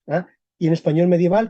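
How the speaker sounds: normal talking voice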